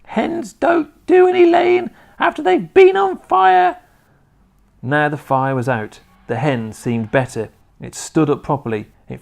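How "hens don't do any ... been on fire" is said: put-on very high, loud old-woman screech; piercing sustained holler; Grandma shouting. A narrator's reading. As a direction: shout it shrilly down from the rooftop. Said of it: "Grandma shouted".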